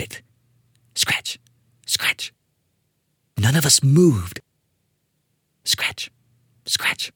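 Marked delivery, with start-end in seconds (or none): 0.9-2.3 unvoiced scratch sound, hushed; soft, eerie scratch effect; whispered scratching. A narrator's reading. Narrator voicing scratching noise. 5.6-7.1 unvoiced scratch sound, hushed; soft, eerie scratch effect; whispered scratching. A narrator's reading. Narrator voicing scratching noise.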